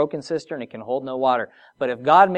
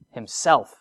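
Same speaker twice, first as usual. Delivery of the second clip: ordinary talk, nothing marked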